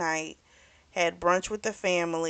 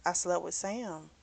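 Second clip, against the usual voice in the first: soft voice; 9 dB quieter than usual. Retold or imitated. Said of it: "so in a soft voice".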